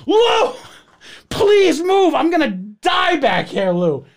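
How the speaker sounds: high, strained-sounding retold plea; desperate pleading. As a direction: beg him to move, hammed-up. Begging neighbour to move, heavy load.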